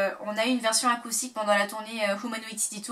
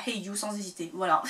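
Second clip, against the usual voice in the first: ordinary talk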